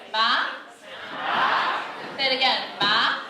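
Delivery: "ma" sweeping upward like a question; rising tone. Shown as a demonstration. Teacher drilling the audience on the up tone, rising. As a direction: lift "ma" steeply at the end. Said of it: "where it goes up".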